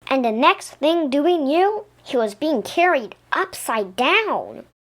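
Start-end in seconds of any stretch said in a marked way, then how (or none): none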